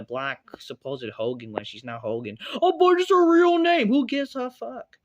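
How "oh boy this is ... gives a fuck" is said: very high, loud mock excitement; squealed fake amazement; sarcastic outburst. Real, not acted. Sarcastic outburst about a wrestler's name.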